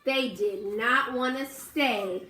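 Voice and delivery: plain reading voice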